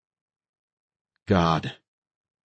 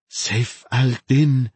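plain reading voice

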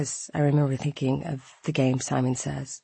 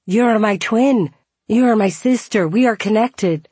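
overjoyed Scottish stranger; excited; 6 semitones higher, 6 dB louder than usual. Retold or imitated. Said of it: "He was excited".

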